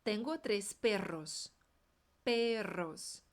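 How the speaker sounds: slow, careful Spanish drill; "Tengo tres perros" spelled out slowly, tapped R in "tres", rolled R in "perros"; slow model sentence. Shown as a demonstration. Teacher modeling slowly for students to repeat. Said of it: "o tres é o R fraco".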